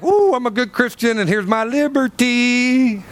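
mock piety. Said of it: "you act like".

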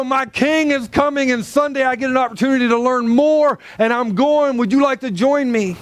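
keen, high-energy invitation; eager invitation. Pretend. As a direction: bubble over with anticipation. Models an eager church invitation.

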